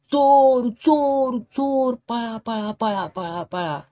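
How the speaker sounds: high shouted cry that fades away; village alarm shout. Acted. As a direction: call out thief, then let it die down. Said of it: "गावात हाळी उठली". (उंच आवाजात हाळी, हळूहळू विरत जाणारी)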